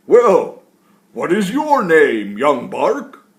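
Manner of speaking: put-on loud, jolly old tree voice; woody tree voice. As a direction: speak like a giant, cheerful old tree. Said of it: "deep, woody-sounding words came out".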